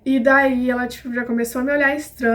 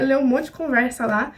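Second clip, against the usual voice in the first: ordinary talk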